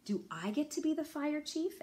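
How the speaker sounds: put-on high, eager child's question; hopeful Wendell. Wendell asks Sophie, high and eager. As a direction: beg for the top job.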